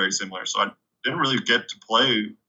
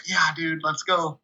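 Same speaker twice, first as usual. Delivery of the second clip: retold burst of eagerness, pitched up; stoked; 7 semitones higher, slower than usual. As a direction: act out being all stoked. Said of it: "all stoked".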